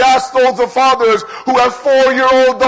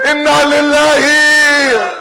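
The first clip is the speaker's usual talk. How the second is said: grieving lament; 3 semitones higher than usual. Acted. Mourning Sakina's death.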